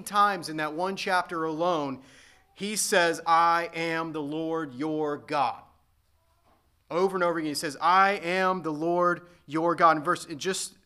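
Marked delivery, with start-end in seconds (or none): 3.3-5.6 very slow and a bit louder, each word weighted; slow solemn proclamation. Acted. Quoting God's repeated declaration. 7.8-10.0 very slow and a bit louder, each word weighted; slow solemn proclamation. Acted. Quoting the refrain again.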